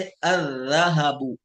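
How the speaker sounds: अर्रहबु slow with a doubled r and no l; Arabic sun letter demo. Shown as a demonstration. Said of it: "राल जैसे".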